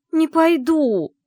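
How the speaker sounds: slow, high, loud refusal; drawn-out shout. Acted. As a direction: stretch out the cry of defiance. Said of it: "почти выкрикнула я". (медленный громкий выкрик отказа)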